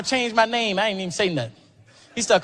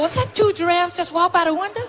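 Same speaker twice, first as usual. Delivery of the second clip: Michael voice; 10 semitones higher than usual. Retold or imitated.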